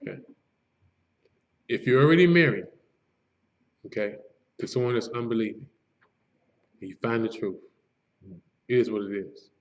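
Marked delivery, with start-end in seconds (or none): 1.7-9.5 lower, softer, broken up with long pauses; slowly, for the listener. Shown as a demonstration. Teacher repeating for a listener trying to focus, slowly. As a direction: say it piece by piece, leaving room to take it in. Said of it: "I want you to say it again slowly".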